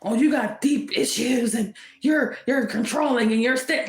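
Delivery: lightly mocking nagging voice, slowed; mocking the accusers. Mocking jealous signs while reading the cards, a mild sneer. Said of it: "it sounds personal".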